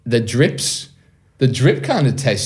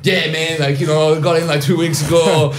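higher-pitched, playing the new arrival; pretend newcomer; 6 semitones higher than usual. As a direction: put on a naive visitor act. Plays along as a new arrival.

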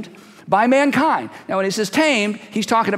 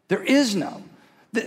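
ordinary talk

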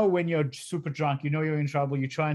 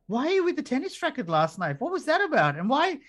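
puzzled cop; 10 semitones higher than usual. Retold or imitated. Officer questioning about tennis racket.

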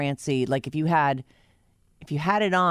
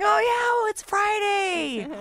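excited; slower than usual. Retold or imitated. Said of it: "I'm like".

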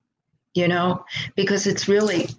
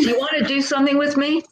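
eager boss ask; 8 semitones higher, much faster than usual. Retold or imitated. Boss calling, retold.

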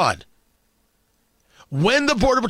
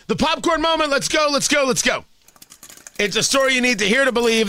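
normal talking voice

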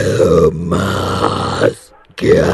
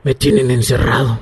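possessed voice; 7 semitones higher than usual. Acted. El poseído habla.

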